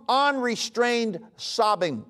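unrestrained stretched slowly and stressed; slow, weighty definition; slow emphasis. Shown as a demonstration. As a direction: lean hard on unrestrained. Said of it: "It means a strong".

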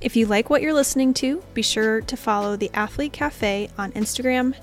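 upbeat podcast plug read over music; promo read. Acted. Host plugging the podcast socials to listeners, promo read. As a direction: sell the show to listeners, bright and clear.

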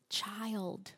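soft and low, tender forgiveness; gentle. Shown as a demonstration. Preacher softly speaking Jesus' words to the congregation, tender. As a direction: hush it gently like comforting a child. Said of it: "Do you hear the gentleness in that?".

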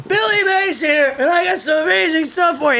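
shouted high infomercial pitch; Billy Mays impression. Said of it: "why are you shouting".

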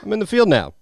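high-pitched, eager announcement; Benji. Retold or imitated. Acting out Benji's proud announcement.

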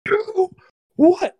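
very high startled mock voice; confused Laura. Mimicking Laura startled in the car, high confused squeak. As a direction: squeak it out, bewildered. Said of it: "she's like".

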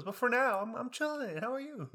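high, breezy mock-cheerful. Student brushing off the counselor, high mock cheer. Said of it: "And I'm like".

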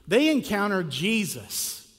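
ordinary talk, nothing marked

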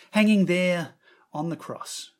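ordinary talk

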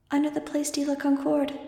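put-on a small girl pleading from far away; scared child calling for help; frightened little girl. Angel's voice in Max's head.

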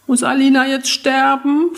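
put-on a small boy asking in fear; frightened small boy. A narrator's reading. Little boy asking the narrator about his sister, a light child's voice. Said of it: "fragte er tonlos".

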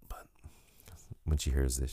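usual speaking voice

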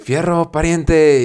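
slow, drawn-out northern Mexican twang; northern Mexican accent, high and slow; norteño accent. Retold or imitated. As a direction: drag it out like a norteño rancher. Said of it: "los que son como del norte es como que".

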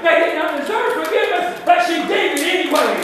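rising shouted preacher's climax; preaching at full force. Real, not acted. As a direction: build it up and shout it to the congregation.

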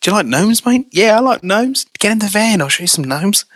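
high-pitched mock creep voice; creepy child-lurer. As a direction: coax like a hammed-up stranger with a van. Plays a groomer luring with gnomes.

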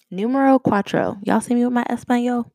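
jokey show-off attempt at a Spanish accent; horrible Spanish accent. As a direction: ham up a terrible Spanish accent on purpose. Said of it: "That was a horrible accent".

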